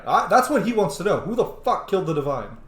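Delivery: ordinary talk, nothing marked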